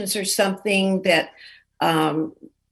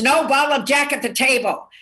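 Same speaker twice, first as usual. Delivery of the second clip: higher-pitched, emphatic bartender's no; mock stern refusal; 6 semitones higher than usual. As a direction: lay down the law, imitating a bartender. Bartender refuses waitress's request.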